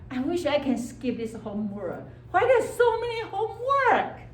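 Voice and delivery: put-on high, whining childish voice; whiny id voice. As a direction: whine like a kid who hates homework. Id voice whining about homework.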